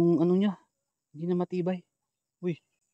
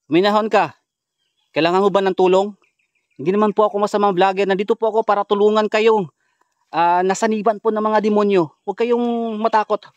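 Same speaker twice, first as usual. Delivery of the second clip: raised, projected appeal; calling out; 4 semitones higher, 5 dB louder than usual. Real, not acted. Vlogger offers help to the possessed woman, calling out.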